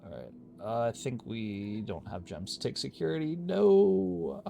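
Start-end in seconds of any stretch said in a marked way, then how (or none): 3.4-4.4 loud, high, drawn-out "no"; dismayed cry. Real, not acted. Player lacking gems for the security room, dismayed cry. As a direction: cry out in real dismay, voice rising.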